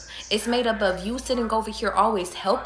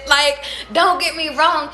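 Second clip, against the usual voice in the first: emphatic; 7 semitones higher, 5 dB louder than usual. Real, not acted. Emphatic aside.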